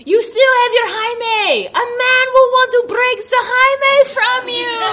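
high, preachy imitation of the doctor; mock doctor. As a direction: lecture her in a high put-on voice. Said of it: "She's like".

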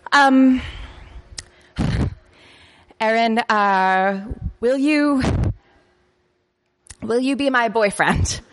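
shy, formal proposal, high; slow, solemn little girl asking; ceremonious. Retold or imitated. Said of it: "in a very, like, ceremonious way".

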